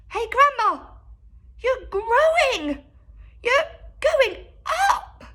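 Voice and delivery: put-on high, loud alarmed boy; shout. A narrator's reading. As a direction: yell up at her in alarm. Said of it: "George gave a jump and shouted".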